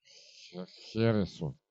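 barely getting the word out; drunk, tongue won't move; slurred drunk. Acted. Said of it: "еле ворочая языком". (еле ворочая языком)

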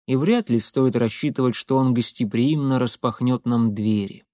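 ordinary delivery, nothing marked

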